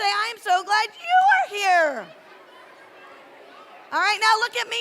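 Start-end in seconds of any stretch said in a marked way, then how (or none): 0.0-2.1 higher and louder the second time; cheerful louder greeting; louder, brighter repeat. Shown as a demonstration. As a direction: say it bigger to the next friend. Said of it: "Turn to your other friend".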